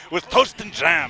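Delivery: a bad British accent put on for laughs; terrible British accent. Co-commentator riffing on a quote, bad British accent. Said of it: "That was a terrible accent by me".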